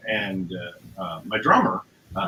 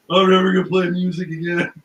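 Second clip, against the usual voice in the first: louder, hammed-up vow to quit; mock despair; 2 semitones higher, 5 dB louder than usual. Retelling walking off stage after a terrible show, mock despair. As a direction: wail it lightly like a defeated musician.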